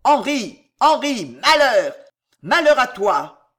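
thundering threat, last words drawn out; terrible cry. A narrator's reading. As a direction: shout a curse over a storm. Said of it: "d'une voix terrible".

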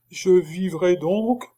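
high, slow trembling question; anxious hope. A narrator's reading. The monk hopefully asks Chicot if he will live. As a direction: ask timidly whether he'll live.

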